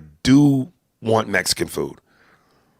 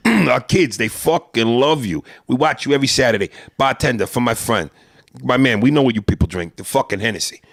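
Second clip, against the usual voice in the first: effusive Italian-American neighborhood guy; old-school Italian-American barfly, hearty and familiar. Acted. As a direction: greet a celebrity like an Italian-American regular. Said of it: "old school Italian dudes".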